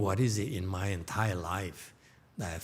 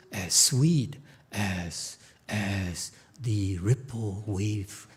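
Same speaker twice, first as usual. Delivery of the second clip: ordinary delivery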